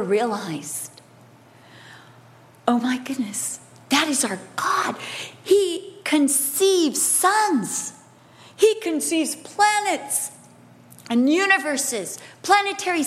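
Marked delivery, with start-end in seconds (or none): 2.7-4.9 breathless unvoiced whisper of awe; awed whisper. Real, not acted. Preacher marvelling at the universe, awed whisper. 5.4-10.3 slow, higher, each word weighed; slow proclamation. Real, not acted. Preacher proclaiming God's creation, slow and lifted. Building awe over creation.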